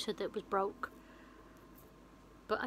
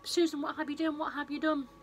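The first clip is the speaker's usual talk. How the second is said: high, raised horrified wail; hammed-up horror, high and louder; mock scream; 6 semitones higher, 3 dB louder than usual. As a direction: act out a distraught outcry, pitched well up. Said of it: "She screams and she's like".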